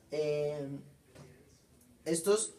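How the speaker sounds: ordinary talk, nothing marked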